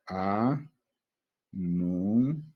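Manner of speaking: "A, nu" as separate held syllables with a pause between. Shown as a demonstration. Teacher dividing a word for students, "A, nu" said syllable by syllable. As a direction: sound out "A... nu" one piece at a time. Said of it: "Ya está separadito acá".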